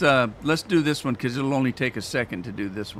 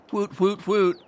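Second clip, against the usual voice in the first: little cheer; 8 semitones higher, slower than usual. Real, not acted. Sunflower seeds ready, cheering.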